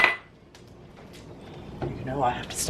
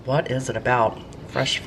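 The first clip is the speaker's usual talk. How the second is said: ordinary talk, nothing marked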